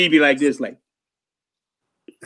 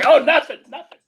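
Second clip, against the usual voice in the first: higher, laughing innocent denial; sheepish laugh; 12 semitones higher than usual. Retold or imitated. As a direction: brush it off with a guilty giggle. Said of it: "I say".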